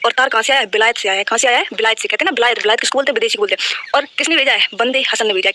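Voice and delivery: ordinary talk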